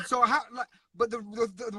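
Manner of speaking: ordinary delivery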